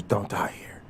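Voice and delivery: breathy whisper into the ear. Retold or imitated. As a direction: whisper it like a secret. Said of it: "the boss whispers".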